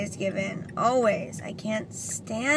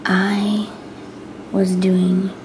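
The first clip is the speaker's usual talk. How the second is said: whispering; 7 dB louder than usual. Real, not acted. Whispers to camera throughout.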